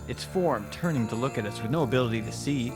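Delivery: usual speaking voice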